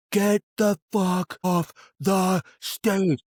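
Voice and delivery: high, very slow drawn-out order; mock yelling. DJ yelling at people to clear out, slow mock bellow. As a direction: stretch every word like a distant bellow. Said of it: "yelling at people".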